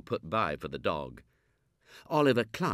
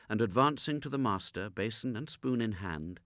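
ordinary delivery, nothing marked

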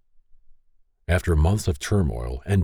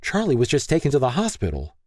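higher, hurried mother voice; rapid worried mother; 8 semitones higher than usual. Acted. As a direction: rush it out like a worried mom. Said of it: "rapidly continuing".

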